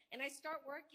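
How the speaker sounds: usual speaking voice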